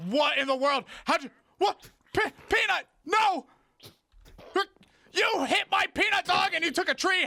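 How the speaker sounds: outraged shout. Real, not acted. Mob hits his dog in game.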